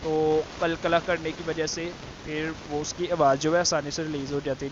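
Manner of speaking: ordinary talk, nothing marked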